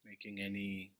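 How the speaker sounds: unhurried meditation guidance with long silences; guided meditation. Acted. Teacher guiding the group into meditation, spacious and measured. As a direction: settle listeners gently, phrase by phrase, leaving long pauses.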